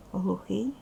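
usual speaking voice

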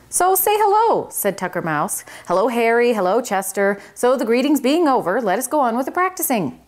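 high, fast-talking fussy little mouse; a busy little manager setting the rules. Acted. Tucker hurries greetings, back to practice.

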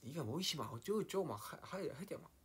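high, animated Jeolla rant; Jeolla dialect. Shown as a demonstration. Mimicking Jeolla speakers for fans, high Jeolla accent. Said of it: "막 이렇게".

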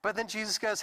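usual speaking voice

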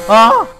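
sudden loud scream of fright; scared scream. Real, not acted. Streamer caught by a cheap jump scare, real scared scream. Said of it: "I got scared pretty easy".

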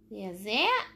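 usual speaking voice

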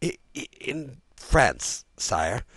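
slow, high hesitant stutter; nervous baron dreading his answer; stammering. A narrator's reading. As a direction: stumble repeatedly before getting it out. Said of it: "stammered the Baron".

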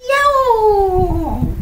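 loud, drawn-out cat yowl; angry cat meow. Acted.

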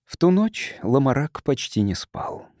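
usual speaking voice

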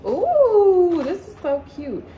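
high, loud squeal of delight; excited. Real, not acted. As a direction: squeal at the cute top. Delighted at a new top.

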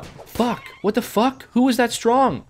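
high-pitched yelp of disbelief; shocked outburst. Real, not acted. Streamer suddenly beaten by a strong enemy, shocked outburst.

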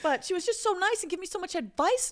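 high, put-on British accent; mock British accent. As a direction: play at a posh British accent. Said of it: "I have a very hard time not mimicking it".

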